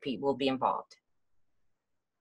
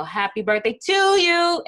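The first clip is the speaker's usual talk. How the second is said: voice out of control; 11 semitones higher, 4 dB louder than usual. Shown as a demonstration. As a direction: let the volume burst out unchecked. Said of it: "your voice just kinda gets out of control".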